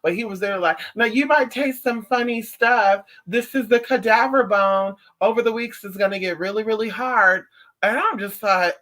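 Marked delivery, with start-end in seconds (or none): 0.9-7.4 a lightly voiced surgeon, a bit louder and higher; imitating the surgeon. A light take on the oral surgeon warning her patient. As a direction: play the doctor's calm warning, only a touch put on.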